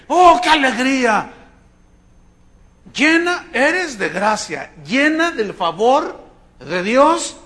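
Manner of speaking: high, loud, slow, exultant greeting; joyful greeting. Acted. Preacher voicing the angel to Mary, high and joyful. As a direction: pour out the angel's joy.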